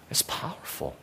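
whispered awe; a hushed aside to the congregation. Real, not acted. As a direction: breathe it out. Hushed climax of sermon.

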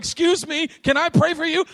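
very high mock-polite plea; tentative offer, insistent. Preacher asking the screaming woman to let him pray, high plea. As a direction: squeak the question over the screaming.

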